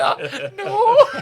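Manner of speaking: high, loud, laughing mock whimper; laughing imitation. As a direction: squeal it out, cracking up. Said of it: "The kid's like".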